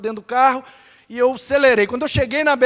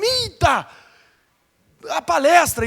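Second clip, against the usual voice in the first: startled exclamation; 6 semitones higher, slower than usual. Retold or imitated. Realizes he is late for talk.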